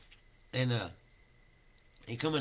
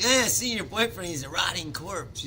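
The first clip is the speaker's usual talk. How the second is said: high, loud mock voice of a friend; mock questioning friend; 7 semitones higher, 7 dB louder than usual. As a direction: tease her in a raised, louder voice. Imagined friend questioning the woman.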